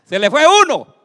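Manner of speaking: high, full-force yell; loud, urgent cry; preaching shout. Acted. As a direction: belt it out to the crowd. Preacher voices demon calling others back.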